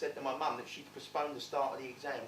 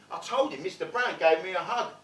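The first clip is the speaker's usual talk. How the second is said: ordinary talk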